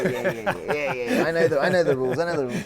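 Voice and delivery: loud, high, laughing exasperation; impatient yes. Retold or imitated. Young fighter cutting off Richard's repeated warning, exasperated. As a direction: brush off the lecture loudly.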